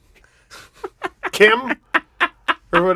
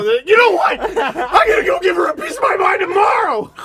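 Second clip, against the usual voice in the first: mock angry customer; 9 semitones higher than usual.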